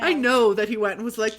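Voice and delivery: usual speaking voice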